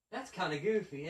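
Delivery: usual speaking voice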